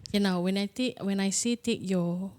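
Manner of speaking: soft, hushed meditation guide; guided meditation. Acted. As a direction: murmur the breathing cues gently. Leads a breathing exercise, softer.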